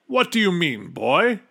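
affronted king. A narrator's reading. King questions Bartholomew's advice, affronted. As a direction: demand an explanation from the boy.

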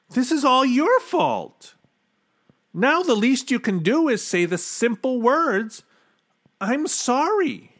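high-pitched, indignant scolding; accusing boy. A narrator's reading. Bartholomew blames king for the Oobleck, accusing. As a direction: confront the king and demand an apology.

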